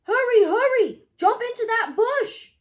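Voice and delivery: loud, high urgent shout. Acted. Hector shouting at Wilbur while distracting a policeman, loud. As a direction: push him into cover. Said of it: "shouted Hector".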